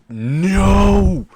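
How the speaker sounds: loud, high, drawn-out "no"; alarmed shout. Acted. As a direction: cry out to stop him. Character's horrified protest as bomb triggers.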